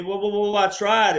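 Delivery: stuttering. Retold or imitated. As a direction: stumble over the words. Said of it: "And he was stuttering".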